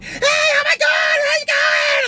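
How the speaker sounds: very high-pitched mock squeal, drawn out; imitated yelling. Driver mimics girl hopping into his car, imitated high yelling. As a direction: imitate an overexcited girl shrieking hello. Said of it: "You don't have to yell in my ear".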